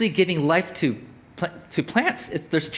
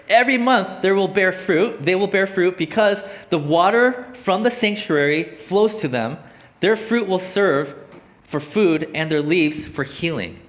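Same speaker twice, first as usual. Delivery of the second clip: scripture reading; 3 semitones higher, 3 dB louder than usual. Acted. Preacher reading of monthly fruit and healing leaves, slow emphatic scripture reading. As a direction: proclaim the verse in measured phrases.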